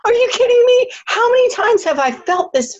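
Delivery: high shout of amazement; incredulous cry. Retold or imitated. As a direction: cry it out in stunned disbelief. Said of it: "I'm like".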